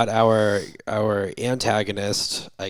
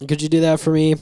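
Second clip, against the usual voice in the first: higher, louder, pushy coaxing; mock wheedling; 6 semitones higher, 6 dB louder than usual. Patient begs receptionist to transfer the call, imitated wheedling. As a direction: act out a patient talking his way past reception. Bob voiced pleading with the receptionist.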